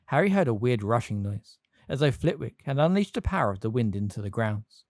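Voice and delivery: plain reading voice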